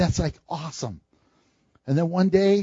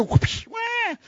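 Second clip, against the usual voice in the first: high little wah of a newborn; mock baby wail; 5 dB quieter than usual. Preacher imitates a newborn baby, high mock wail. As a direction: cry like a newborn.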